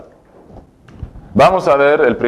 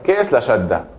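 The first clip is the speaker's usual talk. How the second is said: ordinary talk, nothing marked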